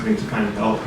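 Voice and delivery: ordinary talk, nothing marked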